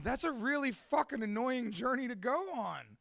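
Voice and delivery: ordinary delivery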